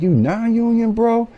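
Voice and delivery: incredulous, high-pitched question; coworker impression. Union coworker stunned he is non-union, high incredulous voice. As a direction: ask it high, in disbelief. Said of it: "He was like".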